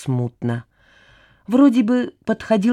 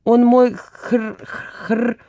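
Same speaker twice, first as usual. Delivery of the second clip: stuck on a word; 4 semitones higher, much slower than usual. A narrator's reading. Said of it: "не могу придумать родственников".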